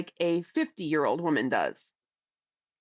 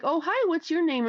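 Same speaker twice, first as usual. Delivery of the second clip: mom's greeting; 8 semitones higher than usual. Retold or imitated. Mom greeting kid in story.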